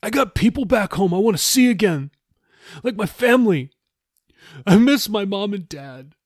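high, pleading homesickness; a touch of a sob in a high voice; tearful. A narrator's reading. Jeffy misses his mom and dad, mildly tearful. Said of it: "He sobbed".

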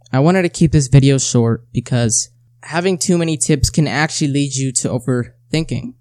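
ordinary talk

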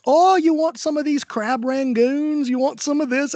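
a hammed-up drunk woman offering crab rangoons to a stranger. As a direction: coo at him like a pushy drunk stranger. Drunk lady pestering Mick.

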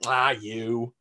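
put-on slow, drawn-out Southern accent; playful Southern drawl, ending in a laugh. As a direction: ham up a charming Southern accent. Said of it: "with a Southern accent".

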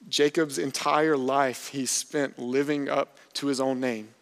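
ordinary talk, nothing marked

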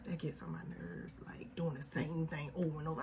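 soft unvoiced aside; whispered aside. Real, not acted. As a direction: drop to a whisper.

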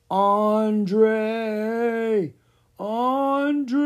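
high, long, loud wailing call; ghostly wail. A narrator's reading. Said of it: "The ghost wailed again".

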